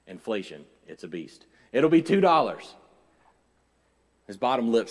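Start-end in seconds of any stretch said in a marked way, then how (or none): 1.7-2.7 louder, higher repeat of the price; emphatic waitress quote. Retold or imitated. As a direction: announce the total emphatically, voice raised. Waitress's price repeated after aside.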